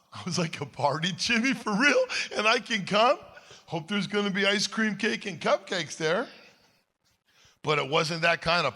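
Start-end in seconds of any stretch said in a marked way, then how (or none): 0.6-6.3 put-on high and louder, a boyish squeal of delight; child dreaming of ice cream and cake; thrilled little kid. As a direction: sound like an eleven-year-old invited to a party. Boy thrilled by party invite.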